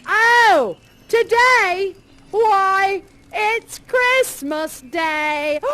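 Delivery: put-on cheeky boy stating the obvious; lightly voiced street boy; boy's voice. Boy answering Scrooge.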